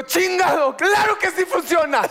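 high-pitched exasperated insistence; hammed-up, high-voiced tantrum; acted intense outburst. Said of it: "te pones muy pinche intenso".

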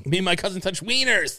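high blurted confession; mock involuntary outburst; cartoon outburst quote. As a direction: blurt it out like a comic tic. Quoting the South Park Tourette's episode.

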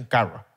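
CARRO with a soft untrilled R; R sin vibrar. Retold or imitated. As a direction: say CARRO with the R left unrolled. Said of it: "Los que se criaron así y por eso dicen". (carro con erre suave, sin vibrar)

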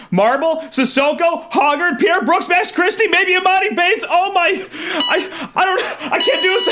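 high, frantic roll-call building to a scream; overwhelmed fan screaming at the top of his range. Real, not acted. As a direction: yell the names in mounting hysteria until the voice tops out. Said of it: "you're screaming like that".

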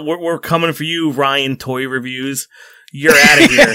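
trash-talk like a pro wrestler on the mic; wrestling promo. Pretend. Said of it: "like a wrestling promo".

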